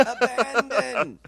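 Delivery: slow, high, stretched-out theatrical cry; drama teacher. Pretend. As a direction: draw it out like a hammy drama coach. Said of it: "you sound like an acting coach".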